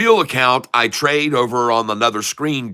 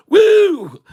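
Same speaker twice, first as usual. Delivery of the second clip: very high, loud whooping holler; 7 dB louder than usual. Acted. Catfish whooping as his trade runs up, loud whoop. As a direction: let out a wild celebratory woo. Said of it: "switched over to the redneck".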